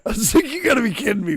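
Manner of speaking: laughing. Real, not acted. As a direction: talk through a laugh. Laughing while retelling his disbelief.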